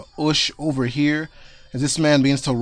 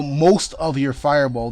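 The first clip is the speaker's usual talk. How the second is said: ordinary delivery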